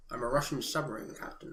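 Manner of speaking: low Scottish-tinged movie line, done badly; understated Connery impression from The Hunt for Red October; bad Sean Connery line. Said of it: "It's gonna be really bad".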